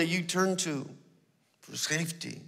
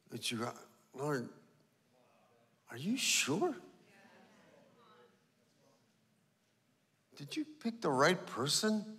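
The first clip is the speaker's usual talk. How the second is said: much softer, frightened words to God; timid prayer; 3 semitones lower, 12 dB quieter than usual. Retold or imitated. Believer quietly doubting God's call, timid and soft. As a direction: whisper your doubts to the Lord.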